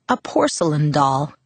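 usual speaking voice

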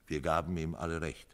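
ordinary delivery, nothing marked

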